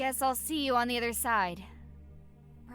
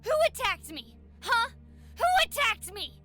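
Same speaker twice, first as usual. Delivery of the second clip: angry outburst; 14 semitones higher than usual. Acted. Sore loser lashing out.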